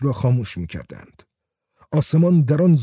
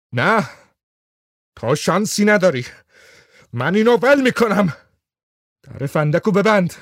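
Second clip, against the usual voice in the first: demanding; 5 semitones higher than usual. Acted. Whitlam demanding the gun down.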